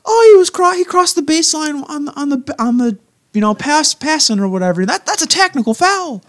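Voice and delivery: high, loud mock know-it-all voice. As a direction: imitate a pedantic fan calling fouls. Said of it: "And I'm just sitting there like".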